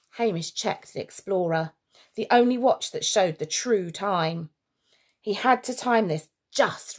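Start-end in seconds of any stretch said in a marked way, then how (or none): none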